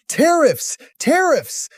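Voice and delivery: high-pitched exclamations, each falling away; mock shouting. Narrator imitates a speaker who starts shouting about tariffs, mock shout. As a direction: call it out high, as if summoning something. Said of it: "he just starts shouting".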